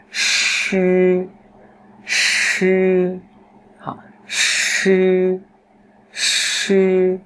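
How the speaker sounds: "sư" as a long retroflex hiss, high level vowel; even airflow. Shown as a demonstration. Teacher models a fricative, "sư" retroflex with steady air. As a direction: curl the tongue, let air flow evenly on "sư". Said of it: "luồng hơi nó ra đều nhá".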